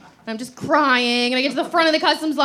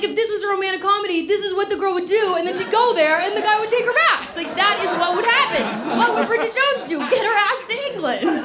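higher, giddy rush of romcom logic; excited inner monologue; 8 semitones higher than usual. Retold or imitated. Telling the crowd her romcom fantasy, giddy and fast. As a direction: talk yourself into the plan, breathless.

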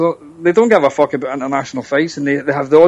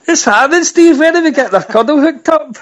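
excited greeting; 10 semitones higher than usual. Real, not acted. Excited reply to a friend.

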